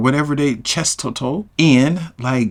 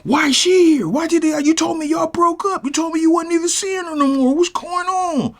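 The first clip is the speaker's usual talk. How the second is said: much higher, whiny accusing voice; betrayed girlfriend. Retold or imitated. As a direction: pitch up, hurt and accusing. WNBA girl accuses Keisha.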